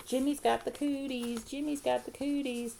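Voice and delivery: high singsong schoolyard tease; yelled taunt. A narrator's reading. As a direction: chant it at them like a teasing girl. Same schoolyard taunt, chasing Jimmy.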